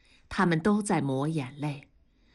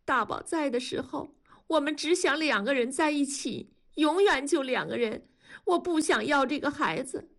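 high, tearful, heartfelt insistence; crying; 8 semitones higher than usual. A narrator's reading. Widow insisting on keeping the baby, crying. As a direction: argue through tears, rising at the end. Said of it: "卢山哭着说".

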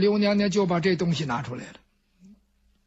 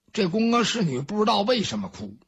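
ordinary delivery, nothing marked

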